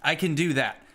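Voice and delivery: usual speaking voice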